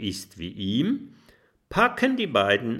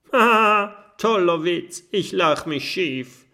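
high, loud mock laugh; sarcastic; 9 semitones higher, 7 dB louder than usual. A narrator's reading. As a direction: scoff at a bad joke. Mathilda mocks the claim. (hoch, laut und spöttisch)

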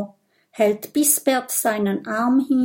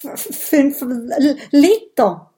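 higher, louder, slow stammering question; shocked stutter; 5 semitones higher, 6 dB louder than usual. A narrator's reading. Minimo gulps at Bisbert's huge demand, stammering. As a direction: stick on the first consonants in dismay.

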